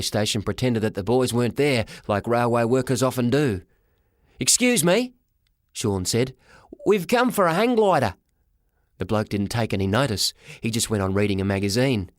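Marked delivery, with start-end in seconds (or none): 4.4-5.1 put-on high, polite young lad; high-voiced boy calling for service; boy's voice. Boy character addresses railway worker. 6.9-8.1 put-on high-pitched young lad making a request; high boyish voice; boy's voice. Boy character addresses railway worker.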